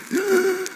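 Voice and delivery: a soft, high, strangled gasp; faint imitated gasp for air. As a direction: catch a thin breath like a dying man. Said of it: "My dad would talk like this".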